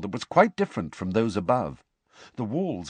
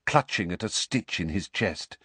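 plain reading voice

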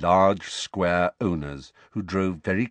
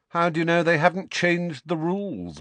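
high, teasing bluff; taunting; 10 semitones higher than usual. A narrator's reading. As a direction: bluff him. Harry bluffing.

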